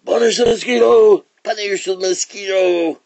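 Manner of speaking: high, chanted outcry of a crowd; animals crying out. Acted. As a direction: call for punishment like a mob of animals. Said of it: "cried all the animals".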